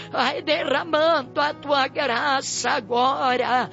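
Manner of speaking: ordinary talk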